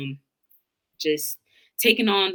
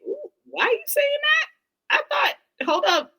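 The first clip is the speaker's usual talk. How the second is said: high-pitched, drawn-out disbelief; slow, high, bewildered recalled reaction; taken aback; slower than usual. Retold or imitated. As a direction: retell a startled double take in a squeaky high voice. Voicing her bewildered reaction back then.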